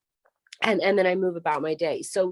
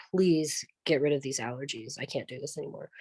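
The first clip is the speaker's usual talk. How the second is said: weary plea; 4 semitones lower, 6 dB quieter than usual. Real, not acted. Weary plea inside prayer.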